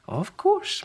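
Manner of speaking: put-on high, slow old granny voice; a cheerful little old lady; high sing-song granny; wee Granny. Granny answers.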